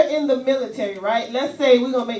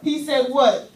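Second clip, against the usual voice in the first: usual speaking voice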